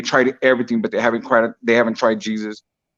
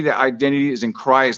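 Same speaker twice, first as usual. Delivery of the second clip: ordinary talk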